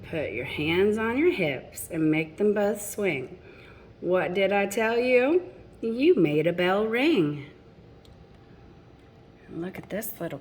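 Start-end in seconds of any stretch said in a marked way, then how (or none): 0.1-7.4 lilting rhymed storybook read; reading to children. A narrator's reading. Parent reading a picture book to children, lilting rhyme. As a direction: read the rhymes to the little ones.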